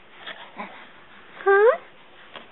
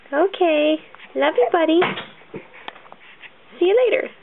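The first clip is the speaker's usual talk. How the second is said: normal talking voice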